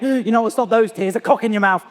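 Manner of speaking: lightly voiced schoolboy taunt; a hint of a mocking teenage bully; annoying year nine voice. His inner year nine voice mocking his tears, lightly voiced. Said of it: "this little annoying year nine voice".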